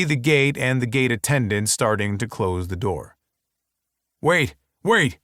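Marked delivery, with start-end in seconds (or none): 4.2-5.2 raised call to stop her; yelling. A narrator's reading. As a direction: shout wait across the hall. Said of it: "I yell".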